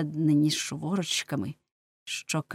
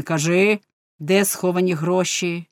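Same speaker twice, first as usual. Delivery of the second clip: judge's demand; 8 dB louder than usual. A narrator's reading. Judge interrogates the tortured woman.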